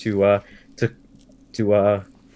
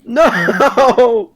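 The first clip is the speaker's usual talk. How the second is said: high, loud cry of dismay; mock horror; 12 semitones higher, 8 dB louder than usual. Player crying out as the mimic dog bites. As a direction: shriek it like the trap just sprang.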